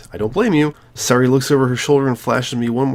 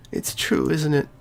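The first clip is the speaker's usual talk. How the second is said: Sayori voice; 7 semitones higher than usual. Acted.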